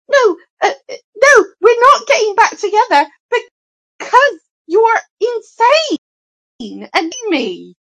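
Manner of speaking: high, loud mock outburst of refusal; hammed-up shouted retort, slowing at the end; imitated shouting. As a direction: act out shouting in someone's face. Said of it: "he just starts shouting in her face".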